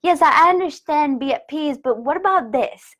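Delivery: ego arguing. Pretend. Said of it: "the ego will be like".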